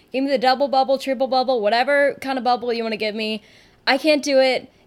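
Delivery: ordinary delivery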